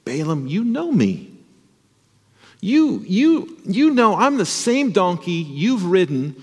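a storybook donkey begging its master to understand. A narrator's reading. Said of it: "And the donkey says".

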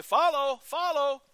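high ringing call of follow; shepherd calling his sheep. Retold or imitated. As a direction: call the flock loudly. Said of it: "would say".

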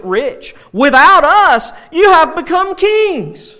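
loud mock acclaim, building to a high cry; sarcastic. Paul chiding the self-satisfied church at Corinth, loudly sarcastic. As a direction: hail them with ringing, exaggerated sarcasm. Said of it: "just sarcastic".

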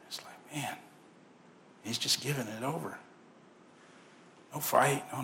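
whispered, deflated; soft unvoiced aside. Real, not acted. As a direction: breathe it out in disbelief. Whispered disappointment.

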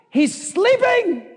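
high, drawn-out shout; exclaiming in disbelief. Acted. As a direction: stretch it out in high-pitched disbelief. Preacher exclaims over sleeping Jesus.

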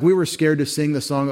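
normal talking voice

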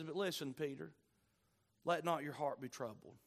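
low, soft, tender reassurance; hushed, loving consolation in retelling; same loving tone. Retold or imitated. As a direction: comfort a troubled friend gently, dropping low and quiet. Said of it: "with the same tone".

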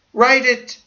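high, loud desperate order; urgent command. Retold or imitated. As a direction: demand it urgently. Dying man's urgent order.

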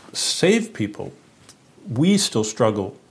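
ordinary talk

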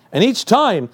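usual speaking voice

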